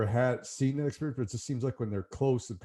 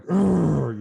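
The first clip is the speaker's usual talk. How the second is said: loud, higher growled grunt; elk growl; 6 semitones higher, 9 dB louder than usual. Shown as a demonstration. Hunter demonstrating the lip balls to host, loud higher growl. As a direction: let out a bull elk's lip-ball growl. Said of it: "sound like".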